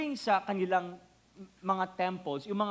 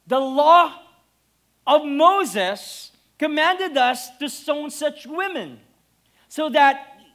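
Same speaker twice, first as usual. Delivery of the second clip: a preacher thundering the law of Moses; preaching scripture; 7 semitones higher, 10 dB louder than usual. Acted. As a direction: proclaim the verses to the hall. Reading scripture at full force.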